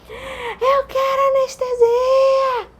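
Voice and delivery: high, drawn-out yell for help; re-enacted desperate high scream; retold bellowing. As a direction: bellow it like a woman in labor. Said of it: "Eu fui berrando".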